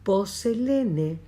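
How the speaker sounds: Poselene said slowly, syllable by syllable; slow Greek drill. Shown as a demonstration. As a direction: spell out Poselene slowly for the class. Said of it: "¿Hacemos el ejercicio?". (Poselene dicho lento, sílaba por sílaba)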